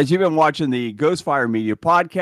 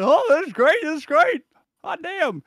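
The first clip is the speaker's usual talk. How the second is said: higher, gleeful "this is great"; giddy mock delight; 11 semitones higher than usual. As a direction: ham up the thrill of the ride. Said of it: "I'm like".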